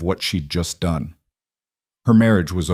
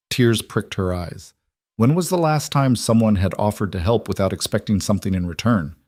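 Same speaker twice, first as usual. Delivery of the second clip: ordinary delivery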